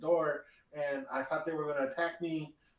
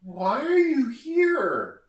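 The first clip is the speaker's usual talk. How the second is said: shrieking gnomish woman; 10 semitones higher, 5 dB louder than usual. Acted. Startled gnomish woman shrieks at the stranger by the stall door. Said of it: "looking up at you and says".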